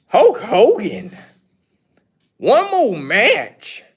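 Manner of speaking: slow, drawn-out disbelieving echo; incredulous. Real, not acted. Echoing a viewer's Hogan request in slow disbelief. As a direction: stretch each word like you can't believe it.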